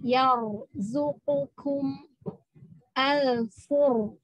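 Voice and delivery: very slow "Yarzuqukum al-furqan", lips rounded on ro; rounded heavy ro. Shown as a demonstration. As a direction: recite slowly with a thick, rounded ro. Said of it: "Mulutnya agak membulat".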